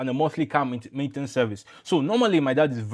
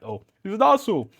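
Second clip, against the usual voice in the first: put-on light Nigerian Igbo accent, pitched up; imitating the Igbo salesman's accent; a touch of Igbo trader accent; 6 semitones higher than usual. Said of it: "he said it with his Igbo accent".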